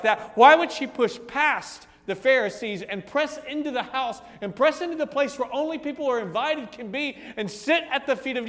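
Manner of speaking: preaching at full force. Real, not acted. Preacher pressing the congregation with rising why questions. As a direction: build the questions into a crescendo.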